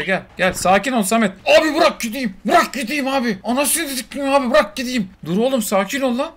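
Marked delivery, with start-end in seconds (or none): 1.5-5.1 high pleading sidekick voice; voiced pet begging. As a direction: plead to be let go. Voicing pet Samet begging.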